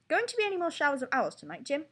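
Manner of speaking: high, loud British anchor banter; British newscaster. A narrator's reading. Newscaster asking Jim about owls, British accent. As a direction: tease the weatherman in a put-on British accent. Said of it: "please excuse my horrible British accent".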